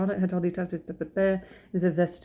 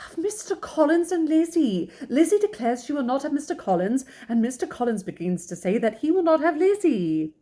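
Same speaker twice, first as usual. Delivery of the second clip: high, agitated complaining wife; worked-up mother with pitch soaring; exasperated wife; 7 semitones higher, 4 dB louder than usual. A narrator's reading. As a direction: insist in a high, flustered voice. Exasperated wife answers husband in story.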